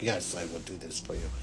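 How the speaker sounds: a kneeling knight's hushed promise; solemn vow. Retold or imitated. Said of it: "He's like".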